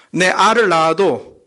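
slow, loud, blunt Gyeongsang dialect. Acted. Preacher imitating the funniest love confession, Gyeongsang dialect. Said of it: "경상도는 뭐".

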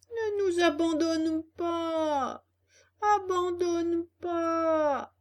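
very slow, high, stretched-out begging; hammed-up plaintive cry; pleading wail. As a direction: moan it like abandoned beggars. Fox and cat beg desperately.

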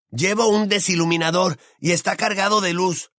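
put-on high, pressing boy's voice; urging. A narrator's reading. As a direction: press a friend to act at once. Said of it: "urgió Ron".

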